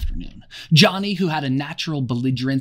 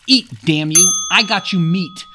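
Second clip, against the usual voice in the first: much louder enraged yell; furious shout; 5 semitones higher, 8 dB louder than usual. A narrator's reading. Jack yelling at Simon, furious. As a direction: hurl the words down.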